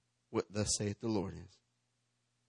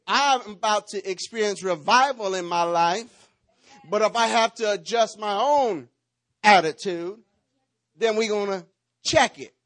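higher, louder, fired-up preaching; full-force preaching; 9 semitones higher, 9 dB louder than usual. Acted. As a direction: preach it hard to the congregation.

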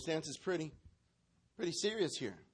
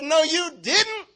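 loud, high, drawn-out disbelief; with attitude; 13 semitones higher, 10 dB louder, slower than usual. Acted. Preacher voices Jesus answering the father, with attitude. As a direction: stretch it into a big scandalised retort. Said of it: "Say it again with attitude".

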